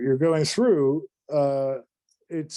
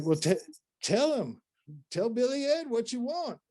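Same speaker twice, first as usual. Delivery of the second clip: put-on Southern lady accent; 9 semitones higher than usual. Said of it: "my accents are horrible".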